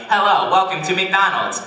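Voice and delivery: high, loud customer-service greeting; cheery robot cashier. Acted. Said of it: "this smiling, cheery robot cashier".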